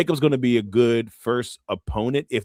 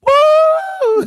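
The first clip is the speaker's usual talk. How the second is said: high, loud whoop; loud woo; 10 dB louder than usual. Retold or imitated. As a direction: let out a long piercing entrance woo. Imitates the shout in the entrance.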